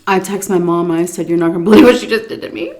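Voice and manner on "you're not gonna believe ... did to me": fast and giggling; laughing, rushed quote. Retold or imitated. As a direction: blurt it out laughing. Texting mom, laughing.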